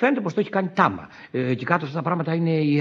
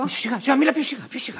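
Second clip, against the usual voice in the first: urgent hushing; 9 semitones higher, faster than usual. Acted. Hushing wife so maid won't hear.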